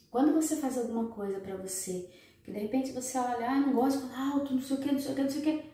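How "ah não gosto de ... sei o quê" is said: quick mock rambling. Voices woman rambling.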